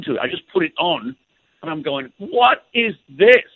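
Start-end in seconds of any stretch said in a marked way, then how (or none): 2.2-3.4 high, loud, drawn-out amazement; stretched-out incredulous exclamation, pitched high; retold astonishment. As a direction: relive being floored, slowly and loudly. Acting out first hearing the record.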